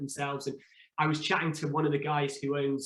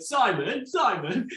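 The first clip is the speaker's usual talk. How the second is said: calling a name across the house, higher and louder; 8 semitones higher, 5 dB louder, slower than usual. Retold or imitated. Contestant calling the detective from another room, raised and high. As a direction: call out to the next room.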